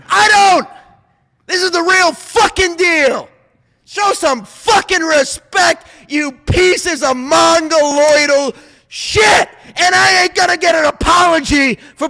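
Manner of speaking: high, loud, slow furious yelling; furious shout. Real, not acted. Ranting at podcast critics in a furious shout. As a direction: bellow it at the haters.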